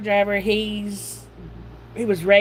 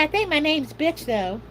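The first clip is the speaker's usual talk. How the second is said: voicing the dog; 8 semitones higher than usual.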